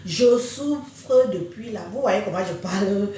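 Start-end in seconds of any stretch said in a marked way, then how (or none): 0.1-1.8 slow, drawn-out words; slow motion. Real, not acted. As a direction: speak slowly, worn down by illness. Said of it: "Je parle au ralenti".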